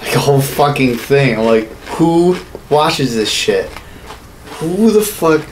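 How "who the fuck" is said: ordinary delivery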